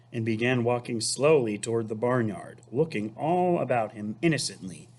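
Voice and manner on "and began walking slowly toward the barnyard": plain reading voice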